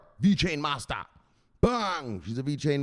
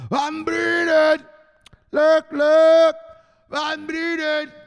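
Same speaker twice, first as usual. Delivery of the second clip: high, loud, drawn-out holler; hammed-up high-pitched shouting; Van Breden yell; 9 semitones higher, 8 dB louder, much slower than usual. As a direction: stretch the name into a high yell. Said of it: "Even the Van Breden yells".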